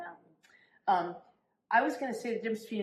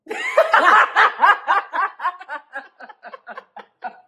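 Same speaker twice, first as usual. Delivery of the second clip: long loud laughing fit; laughing hard; loud laughter; 11 semitones higher, 14 dB louder than usual. Real, not acted.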